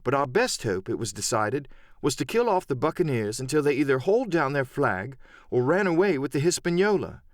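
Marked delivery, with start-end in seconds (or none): none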